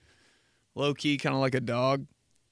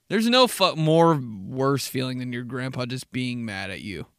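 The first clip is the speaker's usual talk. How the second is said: ordinary delivery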